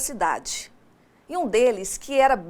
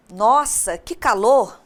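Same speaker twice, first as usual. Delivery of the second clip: "Nossa, que calor!" burst out louder like a complaint; exclamatory intonation; 6 dB louder, slower than usual. Shown as a demonstration. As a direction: exclaim it loudly, as if melting in the heat. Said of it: "Viram só a entonação?".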